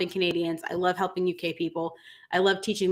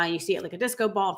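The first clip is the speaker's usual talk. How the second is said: ordinary talk, nothing marked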